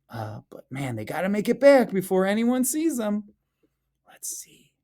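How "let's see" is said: secretive hushed whisper. Acted. Teacher sneaking a look at snowmen, whispering. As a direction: whisper so nobody notices.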